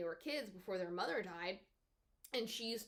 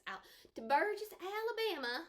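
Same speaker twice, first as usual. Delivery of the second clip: Southern accent; 8 semitones higher than usual. Shown as a demonstration. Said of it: "a bad Southern accent".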